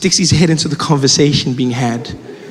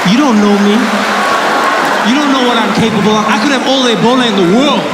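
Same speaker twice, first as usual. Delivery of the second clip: higher-pitched, comically offended boast; mock indignation; 7 semitones higher than usual. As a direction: protest as if your abilities were doubted. Comic indignant boast about himself.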